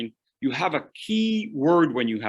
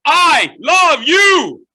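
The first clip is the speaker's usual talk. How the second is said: high, loud, slow shout; shouted I love you; 13 dB louder, much slower than usual. Shown as a demonstration. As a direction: yell it at them. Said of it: "If I said it like this".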